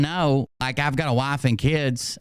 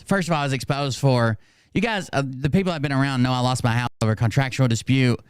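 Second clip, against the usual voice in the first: normal talking voice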